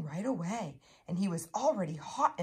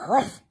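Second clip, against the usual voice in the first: loud, short gruff huff; beaver grunt; 6 semitones higher, 8 dB louder than usual. A narrator's reading. Beaver climbs onto the bank, grunting loudly. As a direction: grunt it out like a big furry beaver. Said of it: "he grunted".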